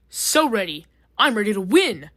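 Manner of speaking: loud, eager shout; screaming eagerly. A narrator's reading. Drill shouting his eagerness to win the challenge, loud. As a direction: yell it, pumped to win. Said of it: "Drill screams".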